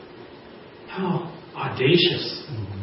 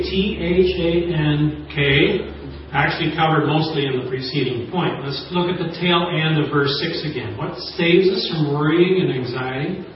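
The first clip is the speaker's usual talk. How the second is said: normal talking voice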